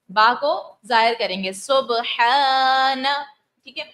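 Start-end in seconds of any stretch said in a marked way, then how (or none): 1.5-3.0 سبحان with the alif after ح stretched slowly; drawn-out alif. Shown as a demonstration. Teacher showing students how to lengthen the alif in سبحان. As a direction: hold the long aa in سبحان. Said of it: "الف سے پہلے زبر ہے".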